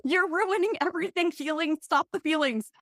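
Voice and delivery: high, mock-panicked inner voice. Guest mocking her own panic at crying, high and frantic. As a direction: act baffled and frantic at your own tears. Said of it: "and you're like".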